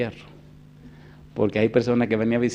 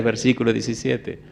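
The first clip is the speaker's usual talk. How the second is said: ordinary talk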